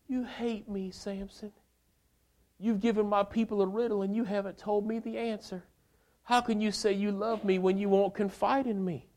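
high, pleading wife, a touch of tears; whiny crying wife. Acted. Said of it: "She cried".

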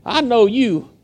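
put-on a high, light girl's voice accusing Peter; servant girl. Servant girl recognizes Peter by the fire, high accusing voice. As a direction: voice the servant girl pointing him out. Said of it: "a little servant girl who says".

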